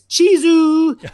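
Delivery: Chizu drawn out very slowly on a high pitch; Japanese photo cheer. Shown as a demonstration. Posing for a photo in Japan, slow high Chizu. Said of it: "you have to say, instead of cheese".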